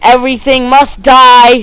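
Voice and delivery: loud, high, slow villain proclamation; hammed-up villain declaration; villain's threat. A narrator's reading. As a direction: declare doom, drawing it out. Villain's threat in narrated story.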